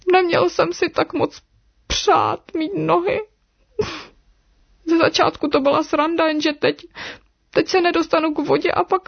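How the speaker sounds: high-pitched, acted tearful wail; little snake weeping, broken by sniffles; crying. A narrator's reading. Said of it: "začal Ríša plakat".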